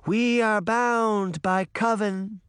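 slow solemn echoed vow; echoed incantation. A narrator's reading. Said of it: "Daphne said".

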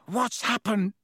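high, breathless alarm; gasped. Acted. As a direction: gasp it out in fright. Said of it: "Ron gasped".

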